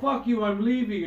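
heated retold outburst; retold angry exit. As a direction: imitate him cursing as he storms off.

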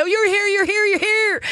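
high, gushing excitement; excited. Retold or imitated. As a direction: squeal like an overjoyed greeter. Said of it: "that excitement of you coming in is like".

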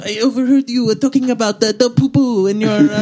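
put-on high, hammy French accent; fake French accent. Replaying the French guy's line.

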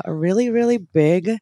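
ordinary delivery

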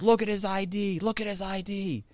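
security chief ordering the cop to check the ID, mock yell; mock screaming. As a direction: demand it twice, high-pitched. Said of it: "so he's screaming".

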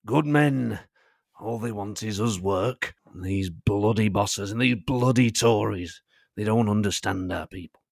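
lightly voiced Yorkshire union leader, slow; union boss. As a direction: speak for the workers like Postlethwaite. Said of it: "It's Pete Postlethwaite in, um, Brassed Off".

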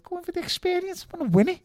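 indignant retort. Retold or imitated. Young boxer protesting to his trainers, indignant. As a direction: push back hard at being written off.

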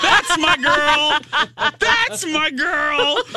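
slow, high, laughing pride; high slow proud gush; proud cheering. Retold or imitated. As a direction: cheer her on slowly, beaming. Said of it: "I was like".

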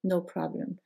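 usual speaking voice

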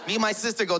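normal talking voice

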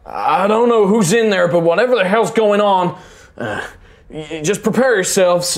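high, loud voice calling into the chamber; unseen man calling in. Acted. Man outside warns whoever is in the crypt, loud high call. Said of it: "you hear a, a, a, a voice".

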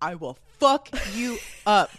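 hammed-up growl of a protective moose; mock moose threat; tough mama moose. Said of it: "the mama moose was like".

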